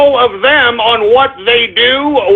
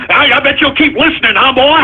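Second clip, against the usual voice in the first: usual speaking voice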